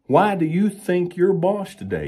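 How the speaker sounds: high, indignant horse talking back; lightly voiced horse, high and put out; sassy horse voice. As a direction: pitch it way up and sass the owner. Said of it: "the horse is saying".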